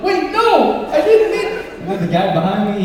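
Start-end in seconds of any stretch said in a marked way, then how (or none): 0.0-1.6 high, loud laughing protest; flustered apology. Retold or imitated. Calling after the woman, a high loud flustered protest. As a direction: scramble to take it back. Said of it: "I was like".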